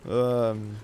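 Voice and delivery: ordinary talk, nothing marked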